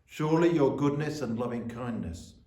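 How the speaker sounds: measured, solemn reading of the psalm; scripture reading. Acted. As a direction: read it like a lesson in church. Reads Psalm 23 aloud.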